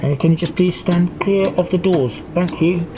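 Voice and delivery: casual driver over the PA system; driver on the tannoy. Acted. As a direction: improvise an offhand tannoy request to passengers. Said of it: "maybe the driver would come up on the".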